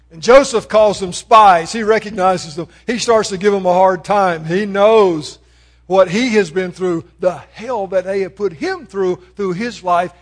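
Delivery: loud, driving sermon delivery; preaching. Acted. Preacher telling the Joseph story to the congregation, loud and driving. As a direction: preach it out with full force.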